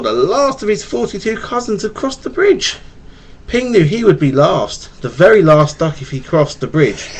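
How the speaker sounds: storybook read-aloud to a little one; reading to a child. Acted. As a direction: read it gently like a bedtime tale.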